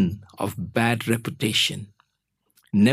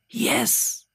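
put-on high, tiny whispered child voice; quiet whisper; 11 semitones higher, 4 dB quieter than usual. A narrator's reading. Child answering about Mummy, quiet whisper. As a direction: whisper it like a secretive kid. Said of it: "He quietly answered back".

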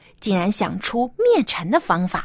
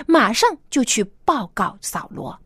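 usual speaking voice

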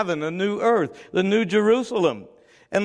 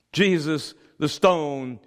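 ordinary delivery

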